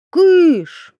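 sharp high, loud shoo; one high, forceful burst. A narrator's reading. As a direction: shout to scare them off. Mikitka shooing away the forest lights.

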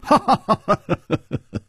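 high rapid peal of laughter; staccato story-hero laugh; hearty laugh. A narrator's reading. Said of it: "楚留香忽然微笑着说".

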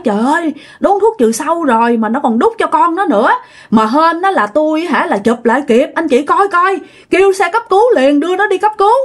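acted panic, pitch climbing steadily; urgent alarm. Grandmother telling parents to call an ambulance, urgently alarmed. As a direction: report breathlessly fast by the end, voice rising.

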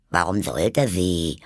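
slow, grumbling Hare voice. A narrator's reading. Hare answers Alice's watch question, grumbling. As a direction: grumble it slowly in character. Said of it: "brummte der Faselhase".

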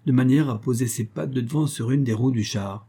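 ordinary delivery

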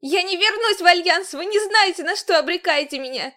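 high, loud defiant cry; defiant shout. A narrator's reading. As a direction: hurl it back in defiance. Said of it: "непримиримо выкрикнула".

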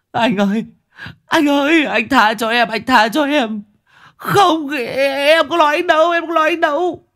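high, wailing plea; panicked victim crying out, voice very high; desperate begging. Acted. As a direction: beg frantically for mercy. Beaten man begs for mercy.

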